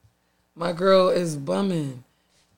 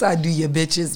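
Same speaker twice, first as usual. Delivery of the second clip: usual speaking voice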